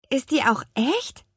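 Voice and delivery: mildly distrustful, high-pitched question; a girl's doubtful query; suspicious. A narrator's reading. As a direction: ask warily, voice lifting. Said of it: "fragte sie misstrauisch".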